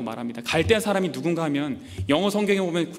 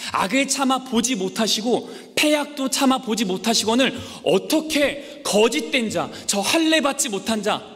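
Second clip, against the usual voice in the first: higher, louder protest to God; the prophet protesting, raised and earnest; second complaint; 6 semitones higher, 4 dB louder than usual. Acted. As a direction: argue with God, pleading and indignant. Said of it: "두 번째 하박국의 불평".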